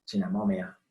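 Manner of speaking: usual speaking voice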